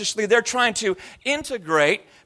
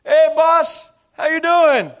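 higher and louder, a hammed-up Arabic Indian accent; 7 semitones higher, 6 dB louder, slower than usual. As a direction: greet the shopkeeper in his Arabic Indian accent. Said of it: "start talking with an Arabic Indian accent".

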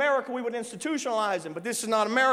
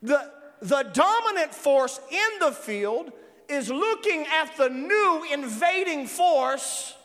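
ordinary talk, nothing marked

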